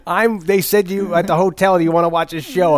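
hammed-up Chris Farley. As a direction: play the clueless American loudly. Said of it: "He's like".

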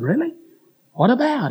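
mildly surprised reply. Retold or imitated. Preacher answering associate's news that she's upset, mock surprised. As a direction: act out being taken aback.

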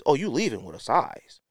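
usual speaking voice

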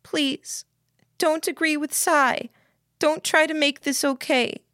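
put-on high-pitched distressed girl; pleading, near breaking. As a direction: beg him with the pitch well up. Lillian begs Jamie, near breaking.

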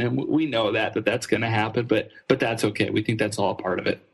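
usual speaking voice

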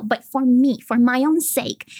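ordinary talk, nothing marked

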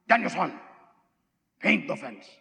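put-on Japanese-accented English, dropping low on the order; calm Japanese-accented English command; Mr Miyagi. Miyagi telling Daniel to paint, Japanese-accented English. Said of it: "I'm doing that character".

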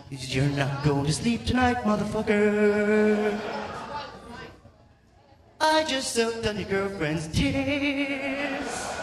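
drawn-out taunting American mosquito voice, rising high; Midwestern American mosquito. Acted. Comic mimicking a mosquito keeping him awake, Midwestern American taunt. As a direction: stretch it out in American twang as a mosquito. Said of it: "a Midwestern American accent".

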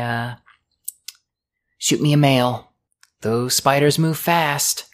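put-on high, bright young woman's voice, friendly and a little awkward; Lucy, cheerful dock girl. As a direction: play the shy, chatty dock worker.

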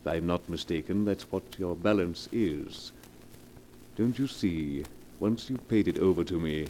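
low, smooth, unhurried menace; oily blackmailer. Acted. Blackmailer coolly naming his price to Mrs Morgan, low silky menace. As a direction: purr the threat, savouring it.

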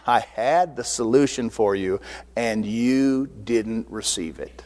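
low, drawn-out taunt in Wisdom's voice; slow, deep mock reproach as Wisdom. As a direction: drop low and rub it in slowly. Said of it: "this is talking about wisdom laughing, going".

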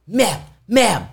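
high, urgent call for attention; retold frantic hailing. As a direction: imitate flustered pleading to be noticed. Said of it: "I'm, I'm frantic".

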